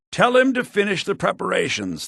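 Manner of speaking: usual speaking voice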